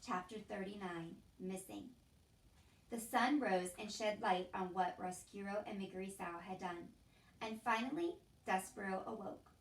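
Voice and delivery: ordinary delivery, nothing marked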